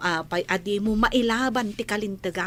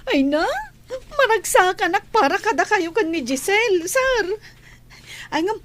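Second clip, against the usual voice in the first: overjoyed; 10 semitones higher, 5 dB louder than usual. Acted. Maid overjoyed for the couple.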